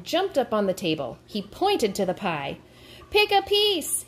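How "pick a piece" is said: high, theatrical shout. A narrator's reading. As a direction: call it out to the whole crowd. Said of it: "he shouted".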